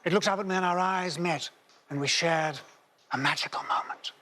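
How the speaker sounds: dreamy, wistful fairy-tale telling; hammed-up grandparent recounting a mythical beast; storybook narration. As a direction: spin it like a bedtime legend.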